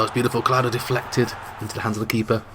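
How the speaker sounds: ordinary talk, nothing marked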